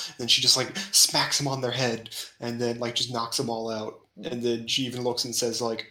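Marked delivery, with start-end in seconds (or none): none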